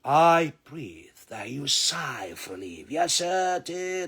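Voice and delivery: ordinary talk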